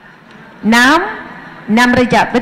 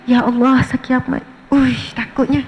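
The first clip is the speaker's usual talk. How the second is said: soft, put-on tearful dread; fake crying; 3 dB quieter than usual. Shown as a demonstration. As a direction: pretend to weep in fear of Judgement Day. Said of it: "Tapi buat-buatlah nangis".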